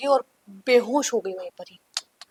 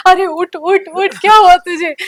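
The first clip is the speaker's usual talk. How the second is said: higher, louder panicked urging to wake up; scared call to wake the fallen girl; frightened calling; 8 semitones higher, 10 dB louder than usual. Retold or imitated. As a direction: shake her awake in fear. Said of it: "मैं कांप रही थी".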